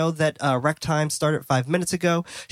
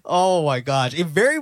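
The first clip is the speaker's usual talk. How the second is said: laughing; slower than usual. Real, not acted. Amazed by a movie line.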